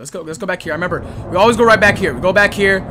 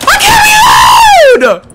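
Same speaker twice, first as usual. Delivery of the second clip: panicked shout; 8 dB louder, much slower than usual. Real, not acted. Panicked gamer in a firefight.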